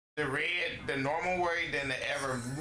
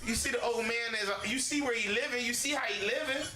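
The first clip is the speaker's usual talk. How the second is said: climbing higher and higher, ending in a shout; indignant outburst; 9 semitones higher than usual. Real, not acted. Fed up with pickers cheating an old man, rising indignant shout. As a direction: get more and more worked up at the lowballers.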